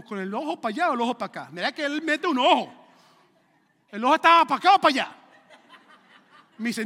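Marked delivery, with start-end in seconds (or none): 0.0-5.2 loud, high, rapid questioning; mock interrogation. Pastor grilling a member about a look, mock-incredulous and loud. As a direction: press the absurd question louder and higher.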